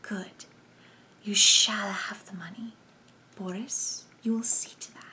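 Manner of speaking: hushed, hissing whisper; soft sibilant whispered command. A narrator's reading. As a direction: breathe it softly with hissing s sounds. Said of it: "the soft, sibilant accents of number one".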